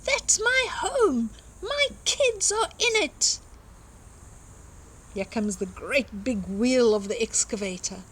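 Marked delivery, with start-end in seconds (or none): 0.0-3.4 tiny mole defying the trucks; Mole yelling. Acted. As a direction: plant your feet and yell at the diggers. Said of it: "yelled Mole".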